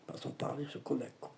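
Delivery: very soft aside, almost whispered; muttered aside. Real, not acted. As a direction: drop it under your breath. (aparté chuchoté)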